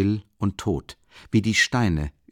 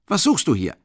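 angry caretaker; 8 semitones higher, 5 dB louder than usual. A narrator's reading. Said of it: "der ernstlich böse wurde".

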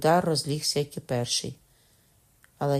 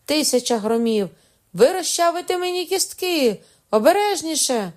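high, loud protest in mock agony; hammed-up pained shouting; 13 semitones higher, 7 dB louder than usual. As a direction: shout as if bones are cracking. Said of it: "закричав Кокона на всі лади".

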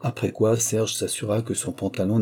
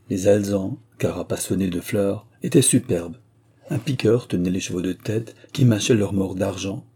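ordinary delivery

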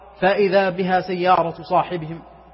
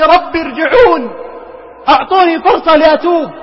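desperate plea; 6 semitones higher, 4 dB louder than usual. Retold or imitated. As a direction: cry out for one more chance. The dead begging for another chance. (توسل يائس بصوت عالٍ)

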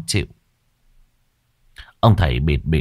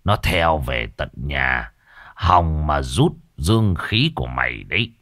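low ominous sorcerer voice; ominous old master; 4 semitones lower than usual. Acted. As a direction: warn of the river ghost darkly. Master explains the ghost.

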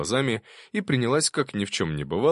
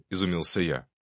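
ordinary delivery

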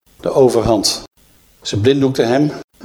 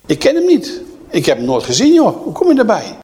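indignant denial; 8 semitones higher than usual. Acted. Peter denying Jesus to the servant girl, high and indignant. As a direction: brush the accusation off indignantly.

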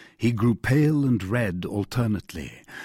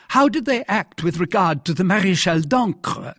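higher, heated royal demand; indignant question in a raised pitch; mildly choleric king; 8 semitones higher, 3 dB louder than usual. A narrator's reading. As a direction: challenge the minister with some anger. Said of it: "in the highest state of choler".

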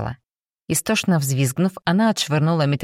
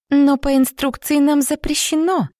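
put-on much higher, sweet girlish protest; cartoon princess; 10 semitones higher, 3 dB louder than usual. A narrator's reading. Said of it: "начала была девушка".

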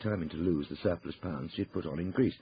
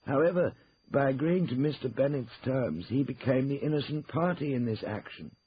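higher-pitched, formal lawyer's voice; formal law firm partner; 8 semitones higher, 3 dB louder than usual. Acted. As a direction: lay out the divorce facts officially. Lawyer sets out the position.

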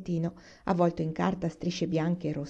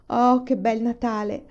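slow, raised sigh of contentment; 5 semitones higher, 4 dB louder, slower than usual. A narrator's reading. Carrie admires her Christmas, slow contented sigh. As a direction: draw it out slowly with a happy sigh. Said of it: "sospirò Carrie".

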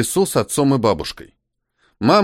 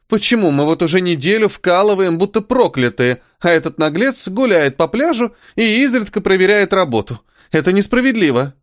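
higher-pitched aggrieved protest; indignant complaint; 7 semitones higher than usual. A narrator's reading. Cynthia griping to her friend about Felix, indignant. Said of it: "воскликнула Синтия".

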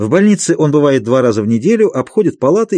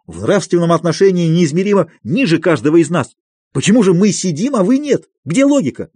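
higher, heated accusing outburst; indignant tirade; 5 semitones higher than usual. A narrator's reading. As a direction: argue hotly, rising to a cry. Patient accusing the doctor.

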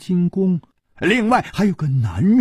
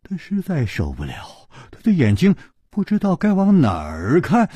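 plain reading voice

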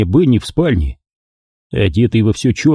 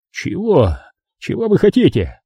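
nervous; 9 semitones higher than usual. Acted. Scared mobster questions killer.

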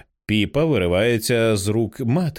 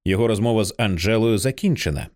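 ordinary delivery, nothing marked

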